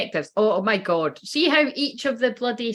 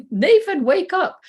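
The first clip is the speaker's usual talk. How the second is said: laughing, higher and louder call; 10 semitones higher, 5 dB louder, slower than usual. Real, not acted. A reader on her live teases a sleepy viewer, laughing loud call.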